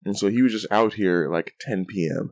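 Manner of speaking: normal talking voice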